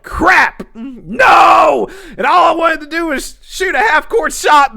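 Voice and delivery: high, loud hammed-up wail; mock despair. Himself fearing big trouble over the shot, mock despair. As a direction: protest it in shouted, exaggerated dismay. Acts his panic at getting in trouble.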